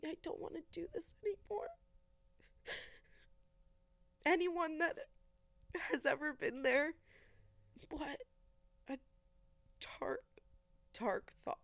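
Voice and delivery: halting, fighting back tears; choked up. Real, not acted. Recalling her darkest night, choked up. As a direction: struggle to get the words out.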